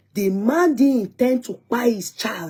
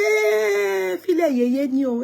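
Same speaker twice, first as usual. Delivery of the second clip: imitated mother's outcry; 11 semitones higher than usual. Daughter mimicking her mother when she cries, high exclaiming. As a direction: imitate mom with a long high 'eh', tumbling down. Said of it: "if you want to beg, my mother say".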